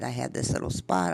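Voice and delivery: ordinary talk, nothing marked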